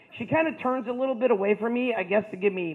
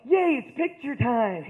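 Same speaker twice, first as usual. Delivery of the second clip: cheerful little brothers; 4 semitones higher, slower than usual. Retold or imitated. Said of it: "They're both like".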